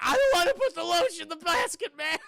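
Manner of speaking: high, loud hammed-up captive cry; exaggerated tearful shouting; mock panicked wail. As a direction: wail it like a terrified captive, overdone. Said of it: "And she's like".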